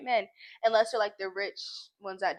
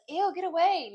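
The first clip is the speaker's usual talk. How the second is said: higher-pitched snooty brush-off; mock disgust; 7 semitones higher, 3 dB louder than usual. Girl acting out the mean reply people expect, mock disgusted. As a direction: act out shooing someone away in disgust. Acts out the snub expected of her.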